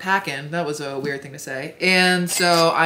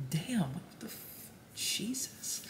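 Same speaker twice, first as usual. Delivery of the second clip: hushed, mostly voiceless reaction; whispered disbelief; 3 semitones higher, 16 dB quieter, slower than usual. Retold or imitated. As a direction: whisper it under your breath, stunned. Mutters her reaction in a retold snub.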